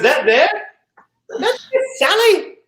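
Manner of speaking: high, hammed-up South African attempt; bad South African accent. Captain naming the other shark, high mock South African. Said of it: "can't do a South African accent".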